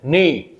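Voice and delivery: "ने" drawn out as the main syllable; primary stress demo. Shown as a demonstration. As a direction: lengthen "ने", falling. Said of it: "ने primary stress है". ("ने" पर मुख्य ज़ोर)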